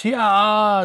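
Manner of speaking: high drawn-out battle cry; sharp sword-attack yell; fighting cry. A narrator's reading. Aoki rushing by in a fight, battle cry. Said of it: "He's taken by surprise".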